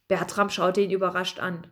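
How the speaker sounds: ordinary delivery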